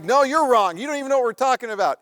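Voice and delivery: high, loud comeback, picking up speed; heated retort. Retold or imitated. Aunt answering her yelling husband, heated retort. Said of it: "He starts yelling at her".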